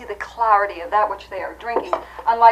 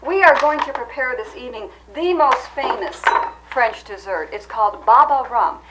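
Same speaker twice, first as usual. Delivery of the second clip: ordinary talk, nothing marked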